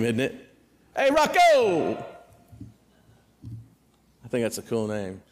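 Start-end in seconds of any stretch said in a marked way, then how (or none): 1.0-2.0 loud, high stadium call across the stands. Retold or imitated. Fan hollering at the center fielder, loud high call. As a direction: yell his name like a fan in the bleachers. Fans calling out at ballpark.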